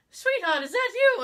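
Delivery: high, loud, incredulous question; imitating someone appalled by a noise; imitated alarm. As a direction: climb high in mock alarm. Retold appalled reaction to cough.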